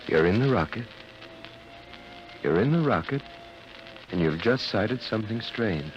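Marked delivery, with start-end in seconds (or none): 0.1-3.2 pressing the words "You're in the rocket" higher each time; insistent suggestion. Acted. Doctor to hypnotised pilot, insistent rising repeat. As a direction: hammer the suggestion home, rising. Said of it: "I have to start him off by suggestion".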